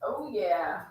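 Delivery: ordinary delivery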